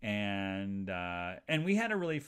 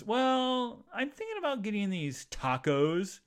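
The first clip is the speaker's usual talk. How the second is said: put-on young guy musing; 9 semitones higher, 5 dB louder than usual. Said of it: "and then he goes".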